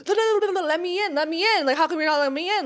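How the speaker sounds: hammed-up pounding on the door; mock angry demand. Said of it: "speaking in angry Chinese".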